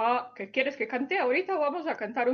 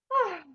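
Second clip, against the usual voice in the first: high little "ah" sigh; showing kids how he sighed; mock sigh; 9 semitones higher than usual. Shown as a demonstration. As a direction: sigh like a sad child. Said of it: "Eliyahu suspiró".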